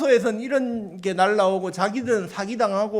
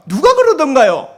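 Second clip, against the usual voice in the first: much louder, higher doubting question; incredulous lawyer; 5 semitones higher, 11 dB louder than usual. Retold or imitated. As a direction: challenge it in disbelief. Skeptical lawyer asks.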